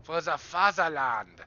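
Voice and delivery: hammed-up Russian accent, proud; mock patriotic cry in a Russian accent; cheesy Russian patriot; bad Russian accent. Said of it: "that was a bad accent".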